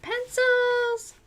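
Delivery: high drawn-out whine of pencils; mock whine. Host imitating Kenny's high whine about pencils. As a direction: groan the word like a reluctant kid. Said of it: "Kenny's like".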